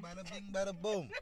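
put-on very high mafia-guy accent; Italian-American mobster. British actor echoing his host's cue, Italian-American mobster. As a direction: echo it as a movie mafioso. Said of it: "You got the Italian".

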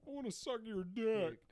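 high, slow silly voice; hammed-up cartoon character. Joke impression continuing bit.

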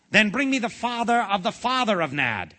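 high, loud royal command; ordering king. A narrator's reading. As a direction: order it impatiently, pitched right up. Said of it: "ordered the king".

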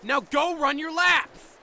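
exasperated yelling order; angry shout. Acted. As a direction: shout it out, fed up. Corporal yelling at squad.

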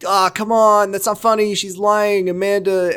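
lightly voiced dismissive guy. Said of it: "Charlie's like".

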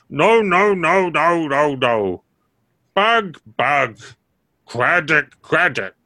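frantic little fungus repeating words; damp muffled mushroom voice. Acted. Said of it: "agitators say".

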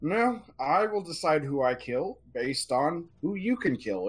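high, hissing reptilian refusal; the oracle Igitz cuts down the centaur, cold and high; lizard oracle voice. Acted. Lizard oracle Igitz refusing the centaur, high and sharp.

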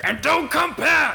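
high, very loud triumphant roar; roaring shout. Acted. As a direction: roar it at fleeing intruders. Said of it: "that got real loud".

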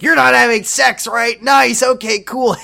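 high, loud mock casual check-in; imitated intrusive barging-in. Host as George barging into Ashley's room, high mock cheeriness. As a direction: burst in with high-pitched, overeager approval.

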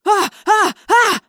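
three loud, high, short yelps; startled yells. A narrator's reading. Tobias scared by her rushing at him, startled yells. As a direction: cry out in alarm, high and loud. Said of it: "he yelled".

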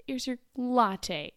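drawn-out, dubious "latte"; air-quoted sarcasm. Retold or imitated. Barista handing over a so-called latte, air-quoted sarcasm. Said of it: "air quotes".

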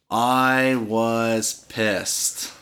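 each word of "I was pissed" stretched out slowly; emphatic drawn-out statement. Retold or imitated. Dog park storyteller stressing his anger, drawn out.

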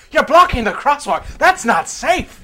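mock irate homeless man. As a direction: imitate an irate homeless man lecturing the fireman. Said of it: "The homeless man was irate".

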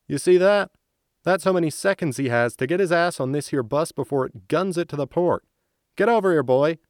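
loud, high, harsh shouting; angry yell. Acted. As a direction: bark the orders across. Soldier yells after rude gesture.

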